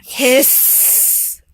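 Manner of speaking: drawn-out snake hiss. Pretend. Parent showing child what the snake says, long hiss.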